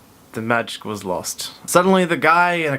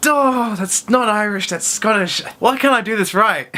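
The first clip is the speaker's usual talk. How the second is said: high-pitched comic exasperation; exasperated with self; 8 semitones higher than usual. Real, not acted. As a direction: wail in frustration at your own botched attempt. Frustrated at own failed accent.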